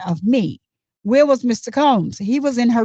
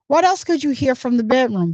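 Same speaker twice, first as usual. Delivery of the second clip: ordinary talk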